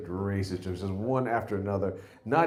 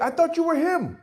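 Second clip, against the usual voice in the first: Uber driver; 5 dB louder than usual. Retold or imitated. Comic mimics the driver misnaming the comic, high and dopey. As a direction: play the clueless driver, pitched way up. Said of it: "He's like".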